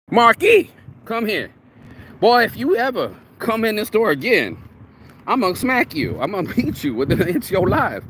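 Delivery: grandma bellowing at her grandson to come here; angry grandma yell. Retold or imitated. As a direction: shout him back to the house like a furious grandma. Grandma yelling at boy.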